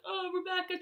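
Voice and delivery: begging her sister to help; pleading. Retold or imitated. To her sister, a pained plea.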